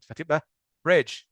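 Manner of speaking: bridge said slow with a short i. Shown as a demonstration. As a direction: stretch bridge, keep the i short. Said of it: "مش برايج".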